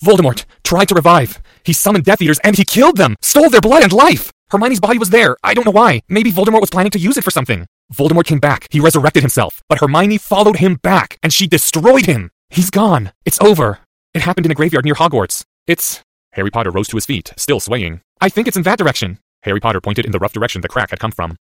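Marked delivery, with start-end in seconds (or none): 0.0-16.0 high, loud, emphatic account; loud urgent report. Acted. Boy telling the professor what happened, loud and urgent. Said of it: "speaking rapidly but loudly". 18.2-19.2 put-on higher-pitched, urgent boy voice. Boy directing the professor toward the crack, urgent.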